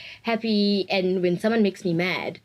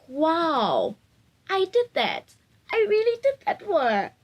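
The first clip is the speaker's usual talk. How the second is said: much higher, marvelling at herself; proud wow; 10 semitones higher, slower than usual. Real, not acted. Marvelling at herself after buying mom glasses. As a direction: beam with pride.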